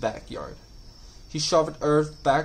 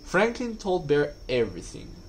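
ordinary delivery

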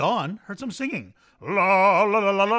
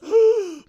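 sharp gasp, much higher and louder; 12 dB louder than usual. Acted. Singer gasping before the confession, loud and high. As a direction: gasp as if the voice cracks. Dramatic gasp mid-sentence.

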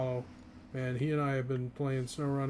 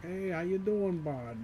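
put-on Vermont accent; 5 semitones higher, slower than usual. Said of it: "the way that people talk up here is very much like".

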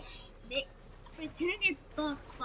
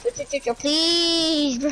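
very loud, strained shout; panicked shout; 16 dB louder, slower than usual. Real, not acted. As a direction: scream at the enemy to stop. Teammate yelling during the fight.

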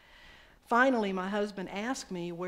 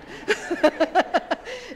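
big burst of laughter, high; loud real laugh; 11 semitones higher, 12 dB louder than usual. Real, not acted. Preacher laughing at the congregation's Southern habit.

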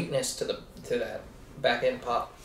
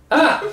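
surprised yelp; 13 semitones higher, 7 dB louder than usual. Real, not acted. Sudden mishap while recording.

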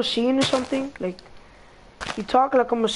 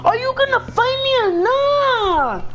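calling out; 10 semitones higher than usual. Real, not acted. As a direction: shout it to find him. Calling out to hiding friend.

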